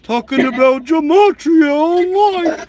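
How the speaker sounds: high, frantic caricature; hammed-up alarm; panicked mock impression. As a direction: rise to a panicked yelp. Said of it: "He's like".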